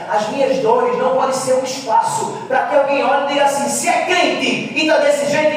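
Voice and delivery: loud, high, impassioned sermon voice; full-force preaching. Real, not acted. Preacher telling the church about a God of joy, full-force preaching.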